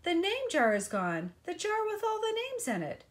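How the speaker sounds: excited high shout. Acted. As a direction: blurt it out urgently. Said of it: "Ralph shouted at him".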